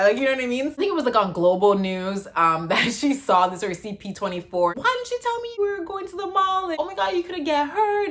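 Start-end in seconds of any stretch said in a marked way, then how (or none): 4.8-8.1 high mom voice, panicked; freaking-out mom. Retold or imitated. Mom scolds her daughter for hiding the mall trip, high worried voice. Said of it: "freaking out that a mom would do".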